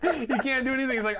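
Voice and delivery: normal talking voice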